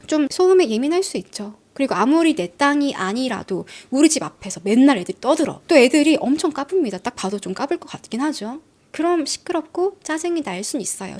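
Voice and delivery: ordinary talk, nothing marked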